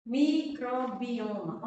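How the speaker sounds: teacher reading out the key word; slow and loud. Shown as a demonstration. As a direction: announce the word slowly and loudly. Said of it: "Três".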